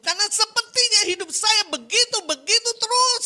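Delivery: loud, high wailing complaint; acted anguished lament at full pitch; imitated tearful outburst. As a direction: imitate a desperate mother crying out. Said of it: "sambil nangis".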